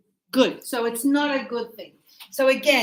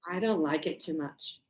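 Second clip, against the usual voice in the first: much softer, lower admission; sheepish aside; 9 semitones lower, 10 dB quieter than usual. Retold or imitated. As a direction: confess it under your breath. Teacher's reply, mock refusal.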